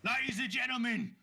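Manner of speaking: high, projected fight-night call; ring announcer. Acted. Announcer addresses the crowd after the fight, high showy call. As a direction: hype up the arena crowd.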